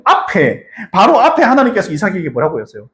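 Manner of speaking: ordinary delivery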